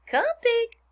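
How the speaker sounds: very high, loud coaxing call; farmer calling. A narrator's reading. Farmer taps the pail for the pig, calling high. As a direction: sing out to summon a pig.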